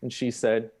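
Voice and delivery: ordinary talk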